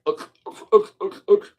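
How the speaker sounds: mock coughing. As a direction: hack like the air is choking you. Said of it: "Now all of a sudden I'm like".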